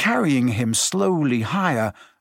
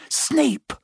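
urgent cry; 11 semitones higher than usual. A narrator's reading. Hermione alarmed, pointing.

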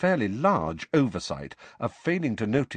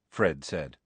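usual speaking voice

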